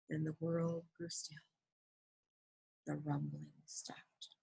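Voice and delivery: very soft, fading almost to a whisper; hushed, suspenseful storytelling; hushed narration. A narrator's reading. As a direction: let it die away into stillness. Narrator hushes as quake ends.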